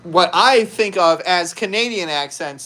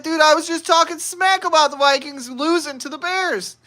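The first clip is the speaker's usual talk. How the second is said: high-pitched retold disbelief; mock dismay; 9 semitones higher than usual. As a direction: act out comic horror at your own blunder. Retold dismay at his own blunder.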